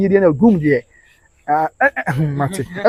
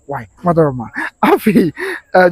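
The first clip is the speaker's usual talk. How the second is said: usual speaking voice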